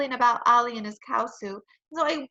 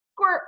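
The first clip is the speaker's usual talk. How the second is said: higher, louder squirt noise; playful squirt sound; 7 semitones higher, 5 dB louder than usual. A narrator's reading. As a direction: play at spraying seltzer. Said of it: "I said".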